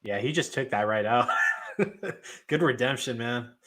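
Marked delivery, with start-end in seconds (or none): 1.3-2.4 laughing. Real, not acted. Laughs at the play.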